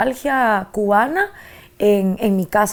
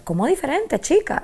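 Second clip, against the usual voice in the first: a hint of the grandmother's voice, chica higher; imitating Cuban grandmother; 6 semitones higher, 3 dB quieter than usual. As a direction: lightly voice her Cuban abuela, amused. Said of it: "Y ella me decía".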